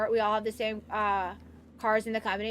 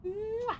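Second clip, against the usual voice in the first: kiss sound; 6 semitones higher, 4 dB quieter than usual. Real, not acted.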